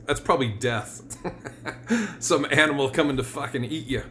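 usual speaking voice

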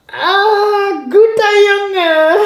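high, slow, squeaky dog praise; squeaky praising a dog. Acted. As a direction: coo it like praising a puppy.

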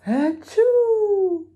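huge high sneeze; big sneeze. Acted. Bear sneezes, loud and high.

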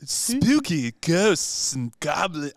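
slow drawn-out ghoul voice; spooky ghoul voice. Retold or imitated. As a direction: stretch it out like a creepy ghoul. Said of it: "That's good".

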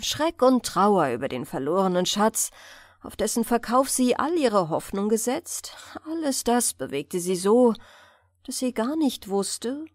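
plain reading voice

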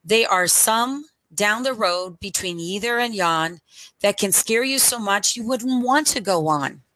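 usual speaking voice

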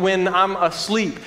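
ordinary delivery

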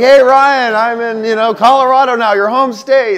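high, loud, over-cheery update voice; loud high-pitched mock enthusiasm; excited friend imitation. Imitates friend's upbeat check-in call.

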